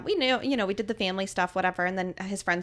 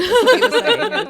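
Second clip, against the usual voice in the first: high and loud through laughter; bursting out laughing mid-sentence; giggling about her husband's silly age joke; 13 semitones higher, 11 dB louder than usual. Real, not acted.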